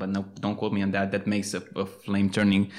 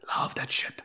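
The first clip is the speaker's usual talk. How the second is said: soft whispered confession; whispering; 6 dB quieter than usual. Real, not acted. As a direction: whisper it like a secret. Confessing love for space colours.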